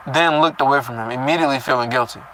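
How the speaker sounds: plain reading voice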